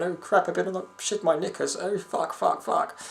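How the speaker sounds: lightly voiced panicked woman in an ad; mock panicking woman. Woman in a diarrhea ad, lightly mocked panic. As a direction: mutter it like a desperate ad actress. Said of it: "walking down the street going".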